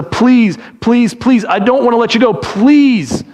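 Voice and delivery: urgent, repeated begging; desperate pleading. Shown as a demonstration. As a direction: plead as if clutching at their feet. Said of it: "this is a grabbing his feet".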